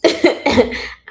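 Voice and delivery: loud, high burst of laughter; laughing. Real, not acted. Author laughing at her own boast, loudly.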